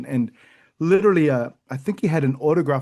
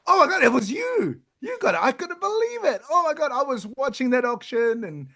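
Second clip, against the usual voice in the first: high-pitched, giddy gushing in disbelief; put-on giddy squeal of excitement; 10 semitones higher than usual. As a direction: imitate a thrilled fan who can hardly believe it. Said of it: "just giddy".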